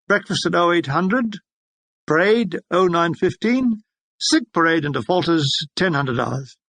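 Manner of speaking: usual speaking voice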